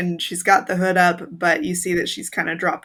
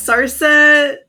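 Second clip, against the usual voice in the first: loud in-character shout; 8 semitones higher, 8 dB louder than usual. Acted. Player bursts into character shout.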